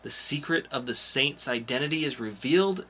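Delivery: bright comic-show announcer listing new releases; announcer read. Acted. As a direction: sell each title like a podcast host. Hosts upcoming comics rundown.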